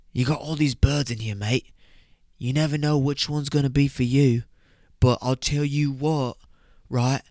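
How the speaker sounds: put-on British lad's accent, rising in pitch to a threat; hammed-up British warning to back off; mock British accent. Lad warns his mate off a girl, mock British accent. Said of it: "So that's how they say that".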